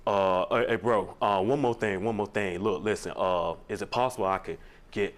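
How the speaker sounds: low, halting mock brother voice; brother's voice. Man mimicking his brother asking for down-payment money, low halting voice. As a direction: hedge and stall in a low lazy voice. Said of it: "and he was like".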